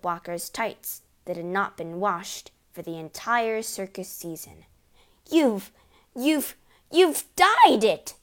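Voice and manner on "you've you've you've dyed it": high, loud shriek, halting then drawn out; splutter, then shriek the accusation; stammering shriek. A narrator's reading. Mother accusing husband of dyeing hair, shrieking. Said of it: "shrieked the mother".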